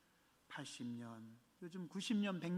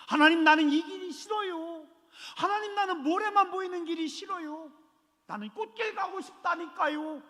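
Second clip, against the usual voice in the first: high, petulant mock whine to God; a preacher acting out Israelites grumbling at God; mock whining complaint; 11 semitones higher, 5 dB louder than usual. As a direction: whine like a child refusing the desert road.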